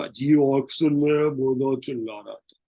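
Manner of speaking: eerie mock voice of the screaming dead; strange dead-man voice. Teacher imitates the dead screaming in the night, eerie put-on voice. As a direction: put on a strange, ghostly accent. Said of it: "professing with accent terrible".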